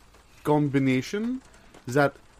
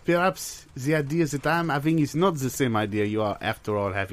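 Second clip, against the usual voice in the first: put-on French accent, fussy accountant; mock French accent. Accountant fretting to his partner about the menu, in a mock French accent. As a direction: play a worried French bean counter.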